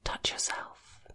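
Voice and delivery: breathy unvoiced whisper; hushed instruction to her lover. A narrator's reading. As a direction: whisper the command close and low.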